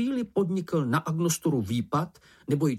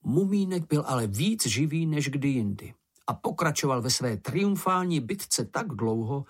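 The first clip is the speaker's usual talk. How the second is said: usual speaking voice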